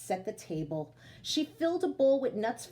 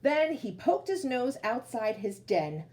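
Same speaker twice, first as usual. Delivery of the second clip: slow, drawn-out read-aloud voice; storytelling narration; slower than usual. A narrator's reading. Teacher reading to children, slow storybook narration.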